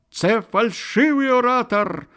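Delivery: high, loud, thin-voiced accusation; high tenor shouting to the crowd. A narrator's reading. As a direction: cry out in a piercing tenor. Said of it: "второй тонкий тенор".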